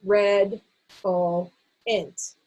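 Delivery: redolent read as red, o, int with pauses; slow, separated syllable drill. Shown as a demonstration. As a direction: read each syllable apart for learners. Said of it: "Do it again".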